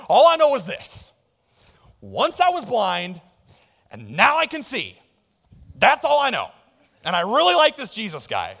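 a simple country man, loud and high. Acted. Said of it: "He's like a country hick or something".

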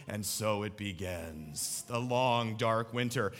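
low, slow, ominous dread; deep brooding prophet of doom; gloomy doom voice. Retold or imitated. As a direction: intone it like winter is the end of the world. Gloomy meme voice, snow coming.

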